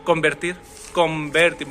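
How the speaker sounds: convertir said as comberti, lips closing before the v; n becomes m. Shown as a demonstration. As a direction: blend the n into an m in convertir. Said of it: "मैं बोल रहा हूँ मैं".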